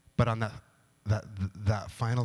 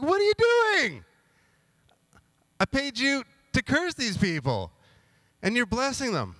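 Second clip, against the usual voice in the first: freaking out; 10 semitones higher than usual. Retold or imitated. King yelling at Balaam who blessed instead of cursed, high outraged squeal. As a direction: shriek at the prophet in disbelief. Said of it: "King Balak is freaking out".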